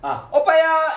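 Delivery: high, loud Gyeongsang call of 오빠야!; 경상도 사투리로 높고 크게 부르는 오빠야. Shown as a demonstration. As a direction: call out 아, 오빠야! in Gyeongsang dialect, pitch jumping up. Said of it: "이거 있잖아요".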